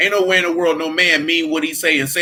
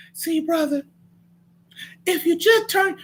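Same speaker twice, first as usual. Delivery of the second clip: mock gentle voice; 13 semitones higher than usual. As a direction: put on a high, meek, pleading voice. Mocking a soft, gentle preacher.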